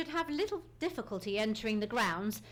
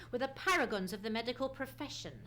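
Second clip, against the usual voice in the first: ordinary talk, nothing marked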